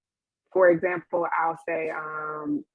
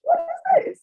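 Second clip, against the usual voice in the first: much higher, alarmed; shocked; 4 dB louder than usual. Retold or imitated. Recalling her panic attack, high and alarmed. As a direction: squeak it out in disbelief. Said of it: "I was like".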